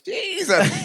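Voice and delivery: amazed exclamation. Retold or imitated. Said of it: "The harmony was like".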